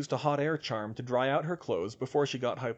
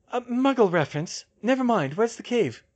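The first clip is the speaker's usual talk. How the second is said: Hermione voice; 10 semitones higher, 7 dB louder than usual. A narrator's reading. Hermione voiced, high pitch.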